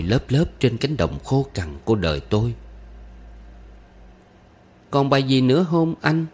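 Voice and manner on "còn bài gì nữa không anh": put-on high child voice asking for more; small girl's high question; eager little girl. A narrator's reading. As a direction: ask as a young pupil, pitched up. Child begs for another rhyme.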